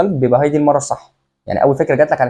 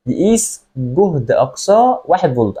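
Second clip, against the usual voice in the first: reading it out slowly, word by word; slow deliberate reading of the misread line; much slower than usual. Retold or imitated. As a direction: spell out the misread question slowly. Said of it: "انا قريتها كده".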